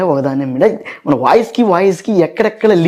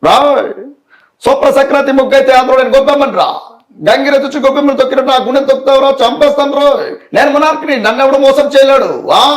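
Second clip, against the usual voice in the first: Prakash Raj impression; 6 semitones higher than usual. Shown as a demonstration. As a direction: threaten like Prakash Raj's villain. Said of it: "తర్వాత ప్రకాష్".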